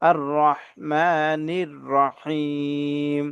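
drawn-out আর রহমানির রহিম with full, heavy r sounds. Shown as a demonstration. Teacher showing learners the r in আর রহমানির রহিম, full and drawn out. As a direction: recite আর রহমানির রহিম slowly, thickening each r. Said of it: "এই রগুলা পোর করে পড়ব".

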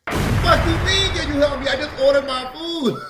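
high, loud, outraged snap; angry retort. Retold or imitated. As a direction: yell at the clerk in disbelief. Acted angry retort to drive-thru clerk.

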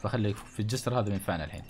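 normal talking voice

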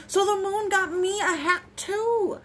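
high, pleased storybook exclamation; exclaimed. A narrator's reading. Bear finds a hat on his doorstep, exclaiming. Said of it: "exclaimed Bear".

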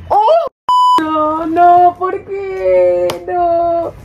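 real little dismay. Real, not acted. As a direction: plead as the toy falls. Toy falls over.

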